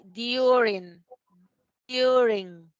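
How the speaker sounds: "during" said slowly as di-u-ring; English pronunciation demo. Shown as a demonstration. Teacher showing how to pronounce the word, "during" slow and drawn out. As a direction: stretch "during" out, stressed on the first part. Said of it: "¿cómo pronuncio yo esta palabra?".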